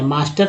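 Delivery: मास्टर as "MAAS-tar"; long "aa" vowel. Shown as a demonstration. Teacher corrects M-A-S-T-E-R, long "aa" vowel. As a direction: say मास्टर with आ in the first syllable. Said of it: "तो यहाँ आकार हो गया".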